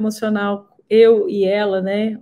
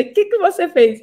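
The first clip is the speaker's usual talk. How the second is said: a higher, amazed repeat of the question; astonished doctor; 8 semitones higher than usual. Retold or imitated. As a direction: echo the doctor's surprise. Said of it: "Ele falou desse jeito". (repetição espantada, mais aguda)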